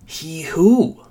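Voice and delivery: high, rising bewilderment; baffled question. A narrator's reading. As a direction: ask it incredulously, swooping up at the end. Narrator voices a baffled question.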